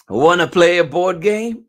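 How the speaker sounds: loud, high, bouncy question; eager story invitation. A narrator's reading. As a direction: call it out like an excited playmate. Reads story question aloud.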